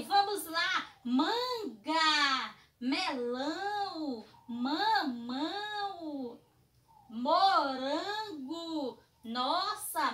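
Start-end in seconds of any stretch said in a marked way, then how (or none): 1.0-9.0 each fruit drawn out, very slow; slow, clear naming. Shown as a demonstration. Teacher naming fruits very slowly for children. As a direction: stretch every word so kids can follow. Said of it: "o universo de frutas que tem a letrinha M".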